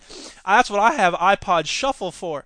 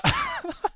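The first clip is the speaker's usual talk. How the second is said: very high short laugh; squeaky laugh; 5 dB quieter than usual. Real, not acted. Host squealing with laughter at the singing.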